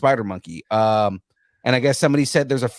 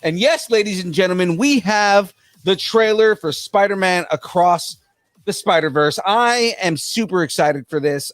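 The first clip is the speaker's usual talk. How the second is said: high, drawn-out segment intro; hyped show announcer; 6 semitones higher, 3 dB louder, slower than usual. Acted. Host introducing the trailer reaction, hyped announcer. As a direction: announce it big, stretching the key words.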